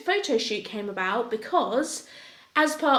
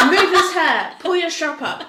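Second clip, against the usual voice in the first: bossy photographer; 6 semitones higher, 7 dB louder than usual. Retold or imitated.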